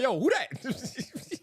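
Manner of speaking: high, stretched-out, slow mock "who that"; mock disbelief. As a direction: pretend not to recognise a friend, drawn out high. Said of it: "I'm like".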